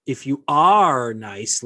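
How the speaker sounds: long, loud stress on "are"; slow quote leaning hard on "are"; mocking readback. Mocking readback of her email.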